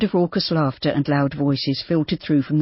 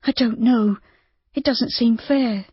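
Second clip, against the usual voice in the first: Judy, hesitant; 7 semitones higher than usual. A narrator's reading. Judy doubting Rob's offer.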